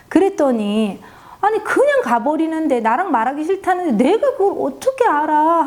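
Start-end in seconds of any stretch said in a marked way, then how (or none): 1.4-5.7 higher, petulant irritated whine; imitating a child's annoyed outburst. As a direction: snap back like a fed-up kid. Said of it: "하면서 막 짜증을 내는 거예요".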